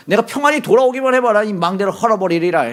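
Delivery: high-pitched, acted vow of revenge; angry threat. Gideon threatening the people of Penuel, high angry vow. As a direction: hurl it as a heated warning.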